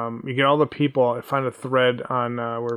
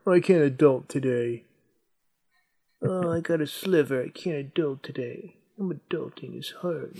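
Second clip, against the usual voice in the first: soft, put-on helpless whine; mock whining; 2 semitones higher, 3 dB quieter than usual. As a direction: whimper the phrases to mock them. Mocking people who say adulting.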